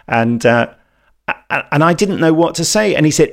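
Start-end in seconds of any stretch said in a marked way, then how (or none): none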